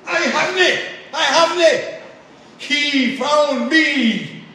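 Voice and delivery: put-on high, shouted Glaswegian accent; Danny McVicar. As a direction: cry it out like a Glasgow evangelist. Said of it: "in his strong Glaswegian accent".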